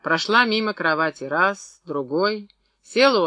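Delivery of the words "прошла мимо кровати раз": ordinary delivery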